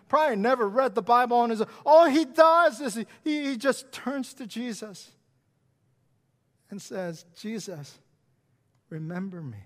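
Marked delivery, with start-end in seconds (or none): 1.8-3.1 loud build. Real, not acted. 7.3-9.6 soft plea. Retold or imitated. Thief's plea repeated softly.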